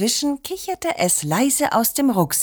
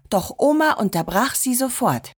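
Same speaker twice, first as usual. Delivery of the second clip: plain reading voice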